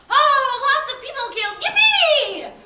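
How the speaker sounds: high, loud mock cheering; mock celebration. Imitating people dancing in the streets, mock jubilation. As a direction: cheer like a gleeful crowd, building to a shriek.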